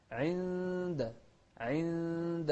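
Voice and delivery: sustained chanted "innnd" on عند; hidden nun. Shown as a demonstration. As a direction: hide the ن of عند in a long hum before د. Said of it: "إخفاء هني".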